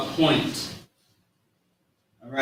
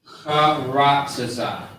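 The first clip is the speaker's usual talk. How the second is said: slow syllable by syllable; much slower than usual. Shown as a demonstration. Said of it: "So the word is".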